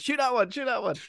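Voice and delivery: high, urgent hammed-up gamer call; mock frantic shouting. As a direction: bark orders like in a shooter game. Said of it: "It's like".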